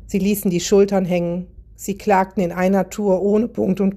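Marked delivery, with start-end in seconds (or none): none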